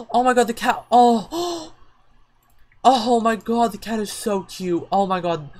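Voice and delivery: high, loud squealing with gasps; ecstatic gushing. Real, not acted. Player overwhelmed by the cute cat, gushing. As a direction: gush breath after breath over the cat.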